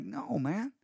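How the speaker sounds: softly voiced, offhand reply; casual gentle refusal. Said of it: "and he's like".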